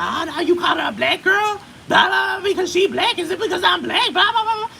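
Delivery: high, loud, indignant caricature; mock outraged American. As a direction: play the offended stateside accuser at full pitch. Mocking outraged Americans again.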